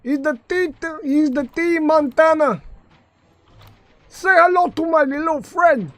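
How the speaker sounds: loud, high put-on gangster holler; Tony Montana. Streamer showing off Tony Montana, loud high mock-gangster voice. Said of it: "we got Tony Montana right here".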